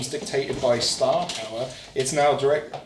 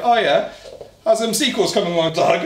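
retold cheeky dig, higher and louder; mock-taunt the actor about his sequels; sarcastic jab; 7 semitones higher, 8 dB louder than usual. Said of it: "and I was just like".

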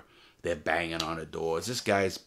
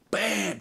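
shouted bam; 12 semitones higher than usual. Retold or imitated. Retelling the beating.

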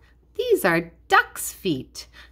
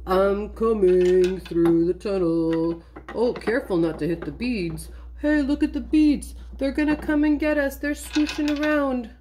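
toy friend arriving. Pretend. Shy friend comes through the tunnel past beads, put-on character voice. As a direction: play the new little character coming through.

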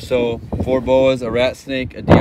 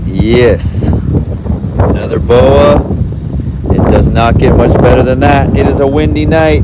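excited; 8 dB louder than usual. Real, not acted. Excited boa find.

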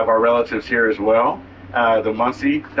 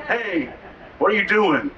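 mock casual hey; 7 semitones higher than usual. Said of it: "just probably not in the proper ways".

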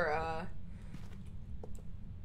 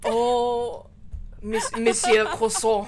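loud, slow, hammed-up French accent; exaggerated French, breaking into laughter; 4 semitones higher, 8 dB louder, much slower than usual. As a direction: announce "Monsieur Croissant" in mock French, laughing. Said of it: "say Croissant in a French accent".

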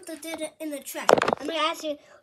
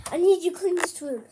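ordinary delivery

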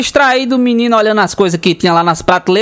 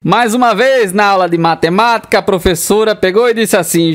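usual speaking voice

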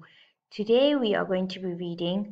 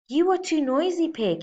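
much higher, louder scolding growl; growling bear; 9 semitones higher, 5 dB louder than usual. Acted. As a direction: bark it at the noisy pig. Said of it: "he growled".

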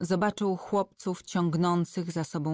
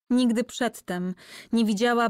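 plain reading voice

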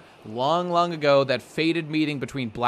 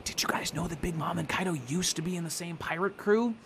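mock whisper; 3 semitones higher, 6 dB quieter than usual. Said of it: "One of the guys is whispering".

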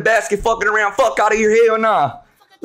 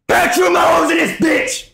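hype shout; 6 semitones higher than usual. Real, not acted. Hyping his team to chat.

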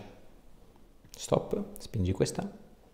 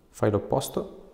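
normal talking voice

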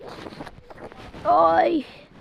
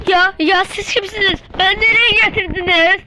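high loud frightened outcry; kidnapped man waking up terrified; panicked shout; 6 semitones higher, 12 dB louder than usual. Acted. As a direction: scream the question at the captors. Kidnapped victim wakes in panic.

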